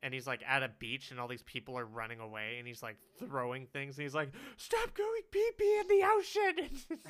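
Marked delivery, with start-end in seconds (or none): 4.6-7.0 very high, loud, drawn-out mock scream; imitated screaming squid. Giant squid to fleeing beachgoers, mock scream. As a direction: shriek it slowly in a put-on creature voice.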